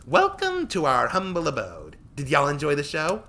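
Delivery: high, loud, slow grand welcome; showy proud girl. Acted. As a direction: show off to guests. Tori proud host.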